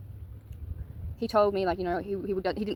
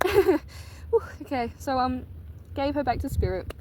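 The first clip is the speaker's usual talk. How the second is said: composing herself; 6 semitones higher, slower than usual. Real, not acted. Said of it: "that was so emotional".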